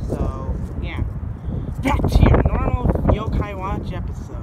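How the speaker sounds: booming announcement to viewers; loud aside. Acted. Host explains the show to viewers, loud aside. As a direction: break off and blare it out.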